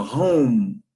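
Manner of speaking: drawn-out "home" closing on a long M; home ending in M. Shown as a demonstration. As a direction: hum the final M of "home", no extra vowel. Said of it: "acaba com M". ("home" alongado, fechando a boca no M)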